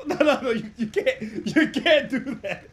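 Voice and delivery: laughing hard through the words, loud and high; can barely talk for laughing. Real, not acted. Cracking up at own impression.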